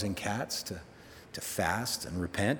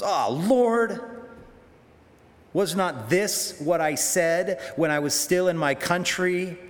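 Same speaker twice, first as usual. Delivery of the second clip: louder, fed-up and bitter, high at the start; Jonah's angry complaint; 5 semitones higher, 4 dB louder than usual. Retold or imitated. Jonah complaining to God about Nineveh, louder and fed-up. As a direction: spit it out like a sulking prophet.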